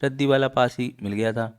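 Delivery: ordinary delivery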